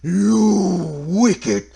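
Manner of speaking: drawn-out, booming spooky scold; scolding ghost. Acted. Ghost catching children out of bed, spooky scolding. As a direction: wail at naughty children like a ghost.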